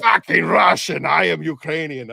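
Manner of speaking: high, shouted imitation of an Eastern European accent; hammed-up outrage in a Russian-style accent; heavy Russian-style accent. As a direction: shout as an offended Ukrainian with a thick accent. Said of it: "very heavy, what I thought was".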